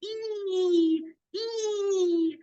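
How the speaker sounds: drawn-out high "ee" wail; crying Kasra. Acted. Teacher shows children how Kasra breaks her lips, long wail. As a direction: hold each cry long and high. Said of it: "تكسر شفتيها هكذا".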